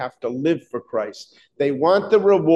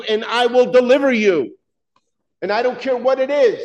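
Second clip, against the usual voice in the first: high, urgent preacher's voice; fervent preaching; 7 semitones higher than usual. Acted. Pastor assuring the church, fervent high preaching. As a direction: lift them up with conviction.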